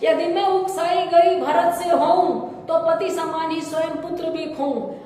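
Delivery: forceful declaimed oath in verse; dramatic Hindi verse reading; poem recitation. Acted.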